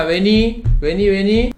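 high, insistent retold call; imitated anxious urging; urgent beckoning. Friend keeps calling him over.

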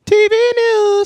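high, slow sung-out show title; jingle voice. Acted. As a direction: croon the segment name like a jingle. Show jingle voice on segment name.

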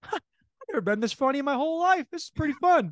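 a father delighted with his own jokes; pleased dad. Retold or imitated. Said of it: "he says".

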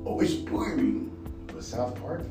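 usual speaking voice

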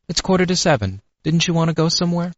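gentle voice. A narrator's reading. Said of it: "That gentle voice".

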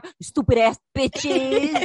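slow, drawn-out teasing jab; slow playful insult at the audience; mock insult. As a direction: drag it out to rib the listeners.

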